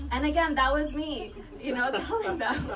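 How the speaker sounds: usual speaking voice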